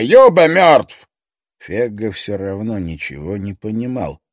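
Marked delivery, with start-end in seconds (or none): 0.0-1.1 slow, loud, high furious shout; explosive rage. Acted. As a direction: yell it slowly, furious. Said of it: "взорвался Стоффе".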